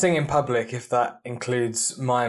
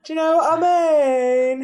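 higher put-on British voice; mock British accent; 12 semitones higher, 3 dB louder than usual. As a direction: join the mockery of Brits. Mocking British speech.

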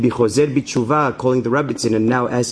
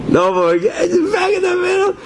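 dramatic alarm. Retold or imitated. Stranded alone in the safari.

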